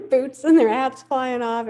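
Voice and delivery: usual speaking voice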